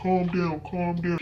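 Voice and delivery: imitated calming dad. Dad soothing mom on speaker, calm and low. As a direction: talk her down in a low tone.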